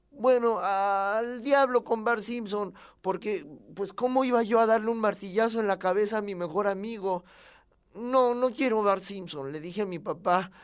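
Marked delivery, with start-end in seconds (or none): 0.1-9.5 put-on high, loud boy's protest; indignant kid standing up for a friend; protesting child. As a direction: refuse like an upset little boy. Child refuses to smash piggy bank.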